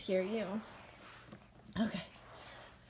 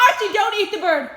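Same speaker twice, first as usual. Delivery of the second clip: loud high command; shouting at dog; 11 semitones higher, 12 dB louder than usual. Real, not acted. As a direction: call the dog off. Dog chasing the bird.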